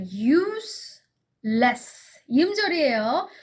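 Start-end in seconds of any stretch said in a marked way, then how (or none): 0.1-2.0 "Useless" slowly split into two beats, use, less; syllable by syllable. Shown as a demonstration. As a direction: count out "Useless" with a gap between use and less. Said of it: "Useless 몇 음절입니까?". ("Useless"를 두 음절로 천천히 끊어서)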